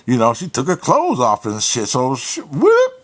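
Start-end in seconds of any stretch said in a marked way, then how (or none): 2.4-2.9 high, loud whoop; a sudden squeaked swoosh noise; imitated whoosh. Storyteller mimics clothes flying off, high whoop.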